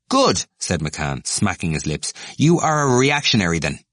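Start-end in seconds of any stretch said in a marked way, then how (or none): 0.1-0.4 sharp high good; a debater scoring a point; satisfied snap. Acted. McCann smacks lips. 2.4-3.8 higher, louder, cutting; brand him with contempt; scornful verdict. Acted. McCann's accusation.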